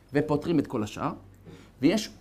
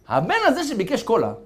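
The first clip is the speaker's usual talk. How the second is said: ordinary talk, nothing marked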